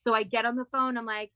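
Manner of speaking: usual speaking voice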